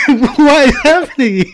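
high, loud and slow through laughter; laughing out the words. Real, not acted. Laughing at cohost.

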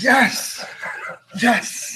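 loud high yes; excited cheer. Real, not acted. Cheering the story.

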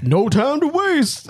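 high, slow mock-dramatic; hammed-up hero line. Dracula picks up the robot girl, hammed-up slow declaration. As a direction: ham it up like a movie hero.

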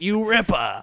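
high, hammed-up Australian accent; broad jokey Australian cheer. Shown as a demonstration. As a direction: exclaim it in mock Australian. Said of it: "try that in Australian accent".

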